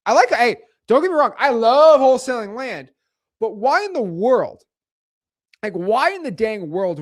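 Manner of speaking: loud, high, insistent disclaimer; emphatic protest. Real, not acted. Streamer insisting he has nothing against land deals, emphatic protest.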